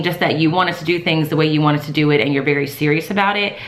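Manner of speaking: ordinary talk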